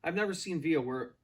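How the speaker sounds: ordinary delivery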